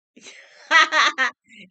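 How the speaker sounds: loud burst of laughter; laughing. Real, not acted. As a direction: laugh out loud at your own remark. Amused by her own remark.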